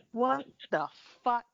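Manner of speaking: loud disbelieving outburst; exasperated. Real, not acted. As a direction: drop the act, stunned. Reacting after mock reading.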